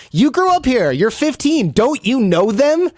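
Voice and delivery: high-pitched, disbelieving challenge; high and baffled, last words stretched out; incredulous protest. Retold or imitated. Retells his teenage protest to host.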